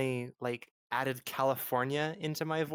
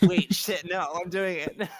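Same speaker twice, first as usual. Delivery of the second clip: louder, higher self-interrupting outburst; mock alarm; 4 semitones higher, 7 dB louder, slower than usual. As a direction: catch yourself mid-slip, half laughing. Acting out catching own voice slip.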